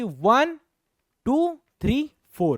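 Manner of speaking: drawn-out count, high on टू three, dropping on फोर; demonstrative counting. Shown as a demonstration. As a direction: count out each swing in a sing-song up-and-down. Counting out each oscillation step.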